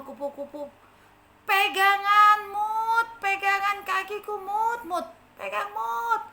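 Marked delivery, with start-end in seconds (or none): 1.5-6.2 high, loud urging to hold on; urgent butterfly rescuer. Acted. Butterfly urges the ant to hold its leg. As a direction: coax the ant to grab on. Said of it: "katanya seperti itu".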